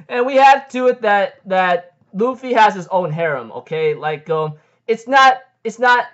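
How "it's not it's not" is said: excited outburst. Real, not acted. Excited outburst about harem.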